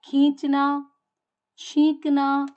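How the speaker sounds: खींचना, छींकना called out loud with nasal ईं; nasal demo words, loud. Shown as a demonstration. Teacher calling out example words with a nasal ईं, loud. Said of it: "उच्चारण इसका अनुनासिक की तरह ही होगा".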